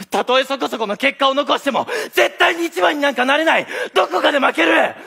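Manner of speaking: rising into a high, loud outcry; frustrated shout. Acted. Tsukishima venting that he can never be number one, shouting. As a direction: build from strained to a high shout of despair.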